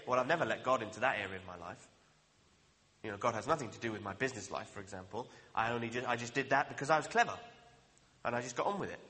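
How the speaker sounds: low, quick brushing-off of God; dismissive imagined believer. Pretend. Preacher voicing a self-reliant churchgoer, low and dismissive. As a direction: wave God away from your business, fast and low. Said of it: "but you can say".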